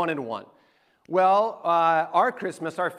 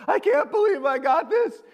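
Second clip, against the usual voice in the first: high-pitched imitation of joyful bawling; mock bawling; 8 semitones higher than usual. Brother unwrapping his Sega Genesis, mock bawling. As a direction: imitate a kid overwhelmed by a gift. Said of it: "he's bawling over there".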